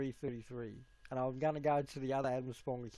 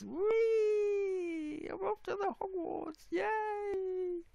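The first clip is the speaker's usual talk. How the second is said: very high squeaky little voice for a toy; slower than usual. Pretend.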